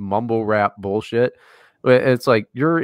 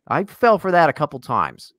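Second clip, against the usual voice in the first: normal talking voice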